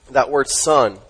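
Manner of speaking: normal talking voice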